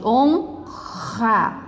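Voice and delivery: "Honra" split, with a long guttural ra; strong R. Shown as a demonstration. Teacher giving an example of strong R, drawn out. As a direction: say "Honra" with a pause, then a raspy h-like R. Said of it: "É o R forte".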